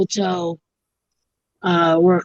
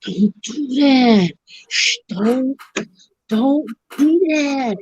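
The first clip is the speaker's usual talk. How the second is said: slow, higher scolding; slow warning; 4 semitones higher, slower than usual. Retold or imitated. The bartender warns the lady, slow and higher. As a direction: drag it out to warn her off. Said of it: "looks at the lady and goes".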